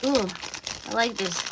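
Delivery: usual speaking voice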